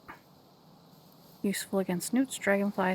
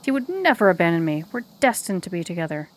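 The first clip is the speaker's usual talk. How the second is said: a sharp, mildly louder snap; snapping with vitriol; 7 dB louder, faster than usual. Acted. Maiden snapping at Granny, who said her beloved left her, mildly angry. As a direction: bite back at Granny in a mild hammed-up huff. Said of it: "snaps at you with sudden vitriol".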